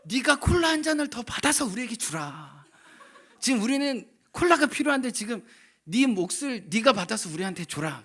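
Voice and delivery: hammed-up strict father voice; mock stern father. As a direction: play the pompous dad demanding the cola. Father scolding son over cola.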